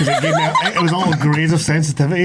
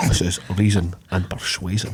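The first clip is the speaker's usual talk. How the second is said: quieter mock Connery Scottish accent; a hint of Sean Connery's Scottish voice, softer; Sean Connery impression; 8 semitones lower, 5 dB quieter than usual. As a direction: repeat it as Connery, lower. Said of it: "does sound like something Sean Connery would say".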